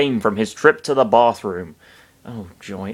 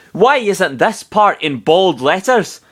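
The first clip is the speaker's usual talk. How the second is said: high, slow indignant complaint; hammed-up fury, drawn out and high; furious; 8 semitones higher, 4 dB louder, slower than usual. A narrator's reading. Narrator voicing Falco's thought, theatrically furious. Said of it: "Falco thought furiously".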